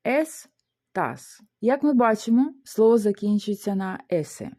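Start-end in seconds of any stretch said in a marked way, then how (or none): 0.1-1.4 syllable by syllable. Shown as a demonstration. Teacher showing the two syllables, syllable by syllable. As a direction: pause between "Ес" and "тас". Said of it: "У нас два склади".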